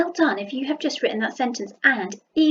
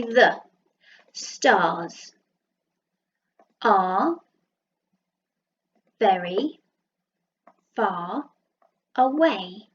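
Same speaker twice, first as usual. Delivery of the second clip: each word split off with pauses; slow dictation, one word at a time; much slower than usual. Shown as a demonstration. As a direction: count the words out one at a time. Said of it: "gonna do some dots to remind me how many words".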